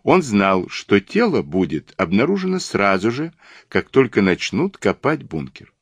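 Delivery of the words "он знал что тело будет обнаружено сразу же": plain reading voice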